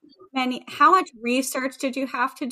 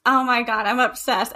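normal talking voice

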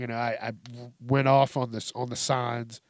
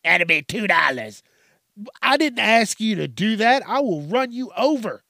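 higher, louder shooing-off yell; mock angry yell; 5 semitones higher, 4 dB louder than usual. Yelling at a junkie washing his car window, mock angry. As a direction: chase off the window washer, mock furious. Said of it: "And you're like".

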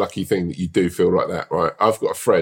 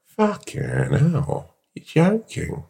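mock shock; 3 semitones higher, much slower than usual. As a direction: play it amazed and disbelieving. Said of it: "And you go".

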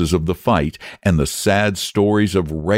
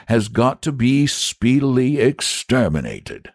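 knight voice; 6 semitones higher than usual. Acted. Saint explaining gravely.

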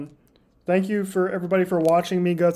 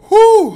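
high loud blowing whew; loud pained whew; 13 semitones higher, 16 dB louder than usual. Real, not acted. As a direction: blow out the burning heat. Blowing out chili heat.

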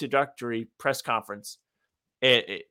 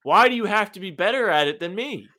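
mock outrage; 7 semitones higher than usual. Real, not acted. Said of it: "mock outrage at cashier".

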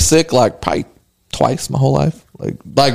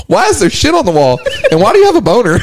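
higher-pitched imitation of the partner; baffled wife; 6 semitones higher, faster than usual. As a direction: put her bewildered questions in a raised voice. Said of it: "And she goes".

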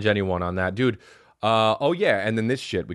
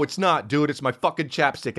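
ordinary delivery

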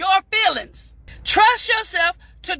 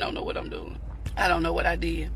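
much softer, lower muttering to yourself; muttered aside; 12 semitones lower, 9 dB quieter, faster than usual. Real, not acted. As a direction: mumble it under your breath, flustered. Fumbling with stream button aside.

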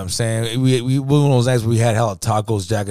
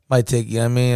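ordinary talk, nothing marked

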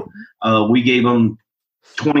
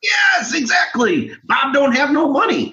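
excited; 13 semitones higher than usual. Real, not acted. Excited agreement about Bob.

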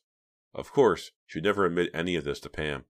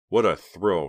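sarcastically; 2 semitones higher, 7 dB louder, slower than usual. A narrator's reading. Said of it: "Reva thought sarcastically".

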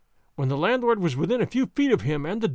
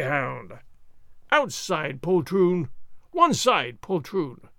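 blustering swordsman; slower than usual. Acted. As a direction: shout taunts at imaginary foes. Drunk sergeant fencing shadows.

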